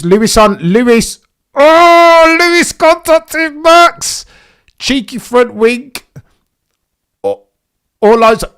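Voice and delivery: yelling as Lewis hits Max; shocked shouting commentary. Real, not acted. Collision seen live.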